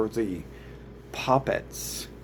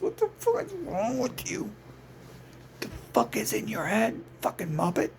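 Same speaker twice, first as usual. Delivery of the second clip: high-pitched disgusted ranting; incredulous rant; 10 semitones higher than usual. Acted. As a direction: scold them like idiots, voice pitched up. Ranting at the troublemakers.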